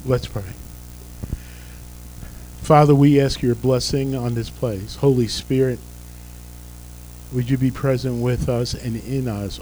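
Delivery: usual speaking voice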